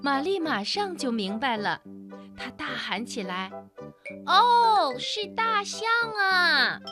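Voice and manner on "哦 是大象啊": high loud slow cry of delight; excited shout. Acted. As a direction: call it out thrilled.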